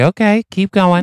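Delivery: lightly voiced encouragement. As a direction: egg him on playfully. Improv character reply.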